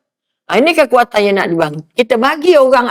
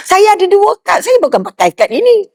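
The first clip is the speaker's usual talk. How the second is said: puzzled helper; 8 semitones higher than usual. Retold or imitated. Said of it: "Tu orang belakang kata".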